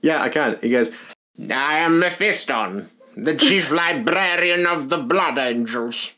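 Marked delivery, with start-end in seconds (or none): 1.5-6.1 slow, higher mock-grand Mephiston voice; hammed-up imitation of a bad audiobook villain; Mephiston narrator voice. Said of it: "Can you give us an impression?".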